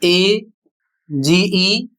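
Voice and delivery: each letter drawn out, A-G-E; slow spelled-out letters; spelled out slowly. Shown as a demonstration. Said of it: "किसी भी शब्द के अंत में".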